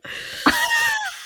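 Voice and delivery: shrill burst of laughter; high squealing laugh. Real, not acted. Giddy about two right guesses, she squeals with laughter.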